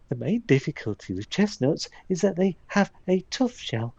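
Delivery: lightly put-on homely woman's voice; Hazel voice. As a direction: play Hazel sharing her home recipes. Reads Hazel's recipe book aloud.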